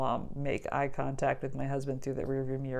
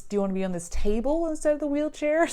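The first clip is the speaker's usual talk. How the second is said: mock sweet nurse; 10 semitones higher, 3 dB louder, faster than usual. Nurses asked her in retelling.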